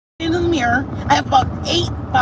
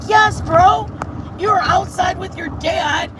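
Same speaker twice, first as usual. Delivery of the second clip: high exasperated retold yell; exasperated shout; 7 semitones higher than usual. Telling Joe how crazy it was, shouting. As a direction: yell it back at him. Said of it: "And I was like".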